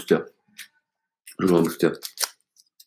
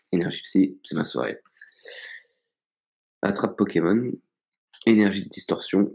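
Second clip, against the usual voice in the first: normal talking voice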